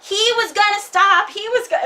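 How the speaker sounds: high, loud singsong protest; defensive protest. Retold or imitated. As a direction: insist loudly he was going to stop. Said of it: "my grandpa's like".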